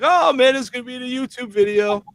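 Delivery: high, teasing whine for busting chops; put-on high-pitched tease; mock complaining. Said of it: "I, I bust chops".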